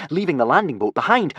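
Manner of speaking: ordinary delivery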